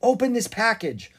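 put-on high, loud girlish command; bossy young girl; young girl's order. A narrator's reading. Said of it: "the girl said to the postmaster".

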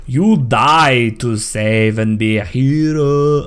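slow, drawn-out bad South African accent; attempted South African accent. Friend imitating the trailer character, attempted South African accent. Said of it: "I can't do a South African accent".

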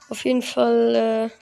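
normal talking voice

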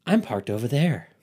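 lightly voiced strange woman; a hint of a put-on stranger's voice; imitated odd woman. Strange woman turns to tell the couple, lightly put-on voice. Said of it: "and she just goes".

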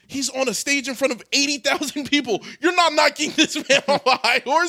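incredulous retort. Retold or imitated. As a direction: scoff at the idea, amused. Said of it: "I'm like".

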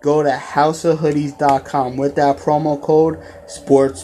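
podcast sponsor spot; ad read. Acted. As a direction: sell the hoodie deal to the fans. Promo code ad read.

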